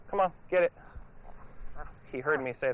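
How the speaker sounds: usual speaking voice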